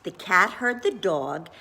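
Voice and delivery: ordinary delivery, nothing marked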